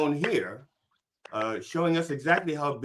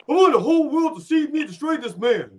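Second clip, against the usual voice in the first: high, loud boxer taunt; Apollo Creed impression; 13 semitones higher, 8 dB louder than usual. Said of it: "this line from Rocky Two".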